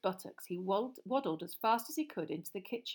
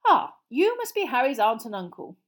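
loud, higher, hearty; genial greeting; 5 semitones higher, 10 dB louder than usual. Acted. Mister Weasley meeting Harry's aunt and uncle, genial. Friendly wizard greets relatives.

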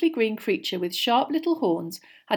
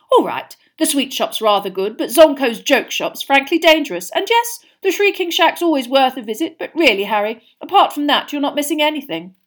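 serious, self-important; 7 semitones higher, 9 dB louder than usual. A narrator's reading. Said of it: "he said seriously".

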